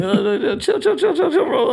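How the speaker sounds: squeaky high chill chill; panicky pleading. Retold or imitated. Teller backing away from the tow guy, squeaky panicked pleading. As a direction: scramble to calm him, way up high.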